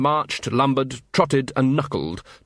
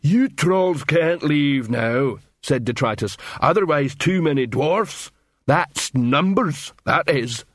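higher, heavily drawn-out troll voice; slow-witted troll; 3 semitones higher, slower than usual. Acted. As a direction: reason it out at a troll's pace. Detritus the troll reasons slowly.

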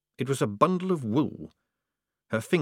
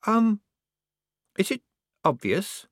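halting, awkward question; hesitant; 10 semitones higher than usual. A narrator's reading. Disguised girl asking if she shows, hesitant. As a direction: ask it haltingly, afraid of the answer. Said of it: "Polly hesitated".

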